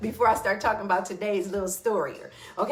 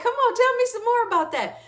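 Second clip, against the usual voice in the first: much higher, louder, bright coaxing call; eager encouragement; 5 dB louder than usual. Retold or imitated. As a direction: urge her on with real excitement. Eager reply to the student.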